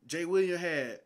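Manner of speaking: ordinary talk, nothing marked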